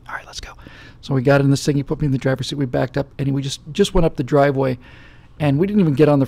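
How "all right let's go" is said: soft whisper giving in; whispered agreement. Retold or imitated. Guest whispering agreement to sneak out for drive. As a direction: whisper yes, let's sneak off.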